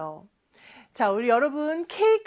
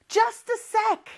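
busy dad; 9 semitones higher, 3 dB louder, much slower than usual. Retold or imitated. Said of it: "아빠는 바쁜가 봐요".

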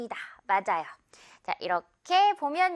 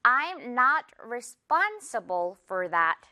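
slow sentence, stress on not; slow careful English demo; slow example read; much slower than usual. Shown as a demonstration. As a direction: model it slowly, leaning on not. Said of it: "예문 볼까요?".

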